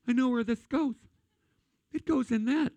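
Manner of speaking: high, mock-gleeful baby discovering a socket. Pastor voicing his baby's thoughts, high and gleeful. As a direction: squeak it out like a baby who just figured it out. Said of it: "they go".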